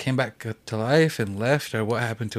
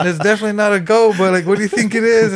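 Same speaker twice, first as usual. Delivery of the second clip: much higher and louder, half laughing; loud amused protest; excited laughing reply; 8 semitones higher, 9 dB louder than usual. Retold or imitated. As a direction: hold back a laugh while correcting him. Friends laughing, answering old man.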